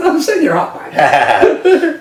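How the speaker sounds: high laughing tease; cracking up while teasing a mate. Real, not acted. Teasing co-host about hiding in bins, laughing high.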